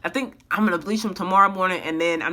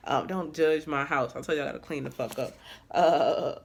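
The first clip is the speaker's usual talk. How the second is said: normal talking voice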